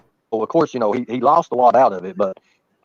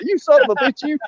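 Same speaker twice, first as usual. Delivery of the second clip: mock cussing; 11 semitones higher than usual. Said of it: "I was like".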